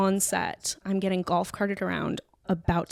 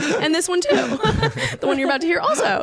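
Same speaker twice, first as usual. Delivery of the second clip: laughing; 8 semitones higher, 4 dB louder than usual. Real, not acted. Laughing while owning the blame.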